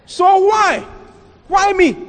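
high-pitched aggrieved questioning; acted indignation. Apostle Paul questioning unfair treatment, acted indignation. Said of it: "he was angry about it".

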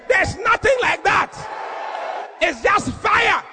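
very high-pitched, shouted hellfire warning; fiery preaching. Real, not acted. Preacher warns congregation of fire and worms, fiery shouting. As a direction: cry out the warning at the top of your range.

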